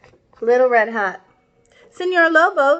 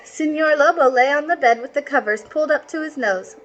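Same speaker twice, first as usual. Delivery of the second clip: ordinary delivery, nothing marked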